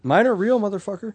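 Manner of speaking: high, loud retort; mock-indignant outburst. Real, not acted. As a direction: fire back loudly in mock offence. Joking mock-indignant comeback on bite marks.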